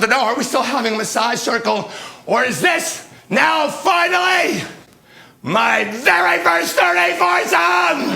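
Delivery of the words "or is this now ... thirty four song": high, slow, drawn-out yell; triumphant shout. Acted. Storyteller announces the climax of his massage circle, triumphant shout. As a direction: stretch every word into a triumphant cry.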